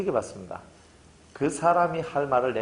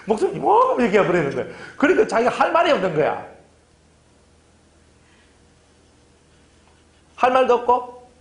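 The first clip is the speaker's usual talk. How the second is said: much higher and louder, flustered; excited; 10 semitones higher, 6 dB louder than usual. Retold or imitated. Congregant flustered that the pastor said more. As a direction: rise up, overwhelmed and speechless.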